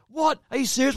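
high-pitched incredulous question; retold disbelief. Storyteller replaying his reaction to the gossip, incredulous.

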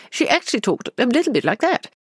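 high-pitched RP, imitating a children's presenter; mother's RP children's-programme voice. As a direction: put on a high BBC received-pronunciation voice. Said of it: "when you listen to my mother".

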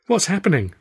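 high-pitched, bewildered question; a touch breathless with surprise; gasped. A narrator's reading. Flustered man asking his roommates, mildly gasping. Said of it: "I gasped".

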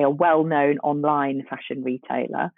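normal talking voice

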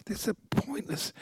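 quiet exasperated thought. Retold or imitated. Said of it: "I just thought".

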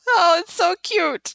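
high, slow tearful squeak; half-joking sobbing over cuteness. Real, not acted. Moved by comic couple.

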